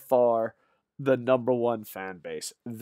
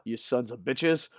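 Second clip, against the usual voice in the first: ordinary delivery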